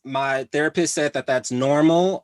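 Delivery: ordinary delivery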